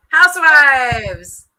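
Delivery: long loud triumphant call; drawn-out cheer. Real, not acted. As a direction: cheer it out slowly. Cheering a team point.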